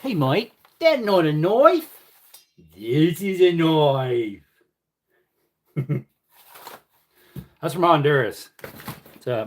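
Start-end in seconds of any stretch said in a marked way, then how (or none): 0.0-4.4 slow, higher mock Aussie drawl, "knife" leaned on; Crocodile Dundee impression. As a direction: deliver the famous knife line with swagger. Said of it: "Crocodile Dundee knife quote".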